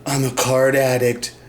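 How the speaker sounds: low, subdued mock addict's confession; mocking impression of a sombre confession. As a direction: confess it in a hushed, deep put-on voice. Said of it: "he's got a problem".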